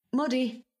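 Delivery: high call carried outdoors; calling the dog. A narrator's reading. Joe calling his collie outside at night, high call. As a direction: call the dog from across the yard. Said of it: "he calls to the collie".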